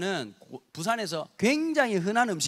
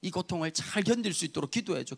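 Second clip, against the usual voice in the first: retold pained plea; 2 semitones lower, 4 dB quieter than usual. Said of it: "너무 고통스러워하면서도".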